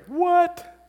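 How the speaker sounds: very high, loud incredulous yelp; mock astonishment. Disciples amazed that the sea obeys, mock astonishment.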